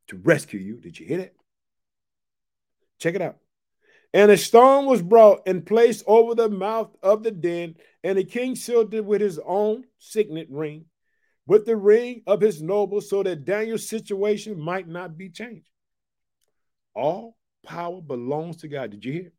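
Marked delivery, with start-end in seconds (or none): 4.1-15.7 raised, steady reading voice; scripture reading. Acted. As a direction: read the passage out to the church. Preacher reads scripture aloud.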